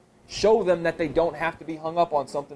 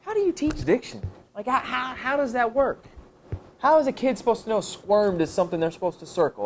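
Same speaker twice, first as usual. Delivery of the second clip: a hint of a puzzled higher voice; lightly voiced skeptical asker; higher, puzzled question; questioning colleague; 8 semitones higher than usual. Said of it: "Someone asks".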